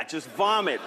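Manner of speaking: ordinary talk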